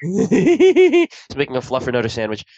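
said through laughter; laughing. Real, not acted. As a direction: laugh while saying it. Laughing joke.

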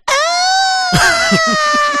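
very high, loud echoing death scream; death cry. Retold or imitated. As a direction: scream like several dying men at once. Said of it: "three guys going".

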